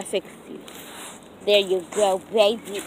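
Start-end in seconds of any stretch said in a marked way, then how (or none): none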